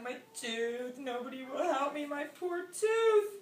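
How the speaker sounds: play-acted bawling, drawn out at the end; mock crying. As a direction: wail it like a toothachy alligator.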